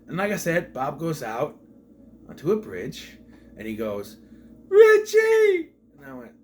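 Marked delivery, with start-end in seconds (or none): 4.7-5.7 high, loud melodramatic howl; hammed-up cry of a lost brother's name; soap opera yell. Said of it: "soap opera level acting".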